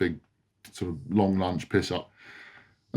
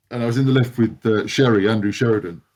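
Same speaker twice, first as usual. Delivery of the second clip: usual speaking voice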